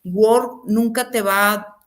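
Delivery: usual speaking voice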